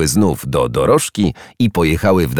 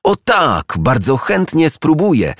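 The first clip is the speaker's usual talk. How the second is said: high, delighted eager yes; thrilled little hedgehog; overjoyed; 10 semitones higher, slower than usual. A narrator's reading. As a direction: accept joyfully, pitched way up. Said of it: "uradowała się Jeżyczka".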